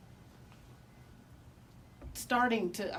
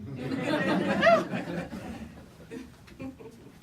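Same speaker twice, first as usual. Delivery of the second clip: a long, loud, high, delighted laugh; real laugh; 6 semitones higher, 5 dB louder than usual. Real, not acted. Remembering his dad's answer, a real delighted laugh. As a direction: laugh out the relief.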